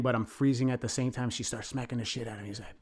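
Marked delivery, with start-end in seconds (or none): none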